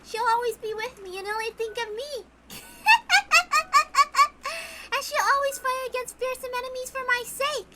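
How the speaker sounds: put-on high, eerie child voice; sing-song, unsettlingly cheerful child; creepy little girl. Maria tells Battler she'll revive her mama, eerie and high.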